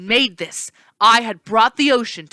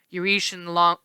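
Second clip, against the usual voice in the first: plain reading voice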